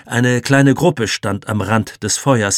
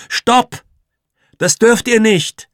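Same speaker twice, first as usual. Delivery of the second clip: shout; 13 semitones higher, 7 dB louder, slower than usual. A narrator's reading. Boy tries stopping fire.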